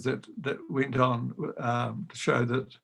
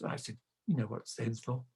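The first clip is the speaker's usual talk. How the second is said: much softer, low conspiratorial aside; hushed reply; 4 semitones lower, 11 dB quieter than usual. Retold or imitated. Choirmaster quietly egging the boy on, hushed. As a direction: drop to a secret murmur.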